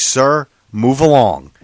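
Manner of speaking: slow, loud order; stern cop. Retold or imitated. Cop sending the arguing driver away, stern order. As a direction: imitate an officer's measured, forceful command.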